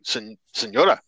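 high, indignant pleading; high-pitched incredulous protest. Retold or imitated. Confronting grandmother in story.

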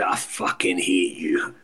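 low, soft, slow menacing growl. Retold or imitated. Drunk teammate growling at a nice guy, low slow menace. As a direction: drag it out low and quiet like a threat. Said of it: "he says".

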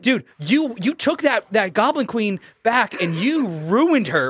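hammed-up player hype; excited retelling. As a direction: play an amazed player reliving the big moment. Acted players reliving a funny moment.

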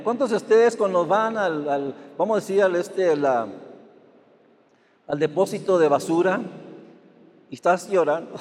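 usual speaking voice

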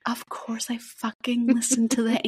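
whispered confession; hushed aside to her cohost. Real, not acted. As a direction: whisper it like a guilty secret. Confiding a guilty pleasure.

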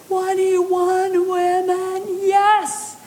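high, drawn-out squeal of delight; slow thrilled disbelief, then a joyful yes; replaying her own delight over the turnout; excited. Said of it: "I was so excited".

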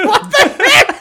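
high, loud, laughing what the heck; laughing disbelief. Retold or imitated. Retelling his reaction to the movie music, laughing hard. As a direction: crack up in disbelief.

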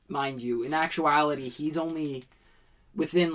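normal talking voice

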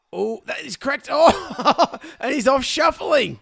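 excited commentary. Pretend. Mock play-by-play of friend batting.

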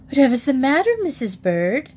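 high, anxious query; concerned question. A narrator's reading. Missus Brown asking Missus Bird what is wrong, concerned. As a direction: ask with worried concern, starting high. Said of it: "looking most concerned".

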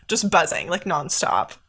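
usual speaking voice

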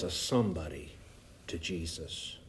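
dropping to a whisper; hushed. Acted. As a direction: lean in and whisper the truth. Sermon point dropped to hush.

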